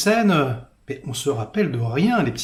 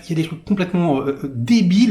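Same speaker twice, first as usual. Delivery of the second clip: ordinary talk, nothing marked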